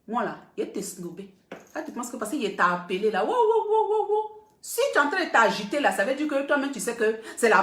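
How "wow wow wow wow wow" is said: high, gushing mock wows; half-laughing mock amazement; mock excitement. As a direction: parody someone thrilled to get the call. Mocks the caller's excited reaction.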